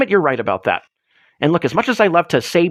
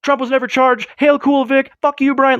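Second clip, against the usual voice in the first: mock insult; 8 semitones higher than usual. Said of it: "be like".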